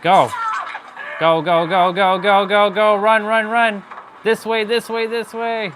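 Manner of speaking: frantic high urging yells; rising panic, hurry them along; being chased. Real, not acted. Urging teammate while being chased.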